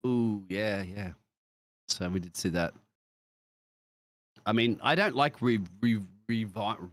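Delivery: ordinary delivery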